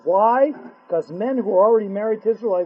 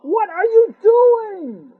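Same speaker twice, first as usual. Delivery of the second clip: much higher, louder, very slow cry; anguished outcry; 11 semitones higher, 5 dB louder, much slower than usual. Acted. Hashem cries out to his holy people, slow and loud. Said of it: "Hashem says".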